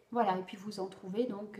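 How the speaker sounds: ordinary delivery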